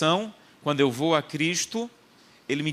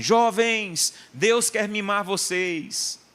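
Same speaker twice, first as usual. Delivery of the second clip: high, drawn-out mock rallying cry; stretched, high-pitched call to the youth; imitated shouting; 6 semitones higher, much slower than usual. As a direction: imitate an excited singer calling out to a huge crowd. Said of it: "começou a gri-, gritar assim".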